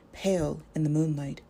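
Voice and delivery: plain reading voice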